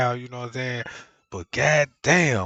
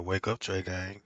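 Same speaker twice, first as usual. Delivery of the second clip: phone voice; 7 semitones lower, 8 dB quieter than usual. Retold or imitated. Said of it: "Be like".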